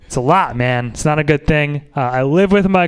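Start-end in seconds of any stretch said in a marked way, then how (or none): none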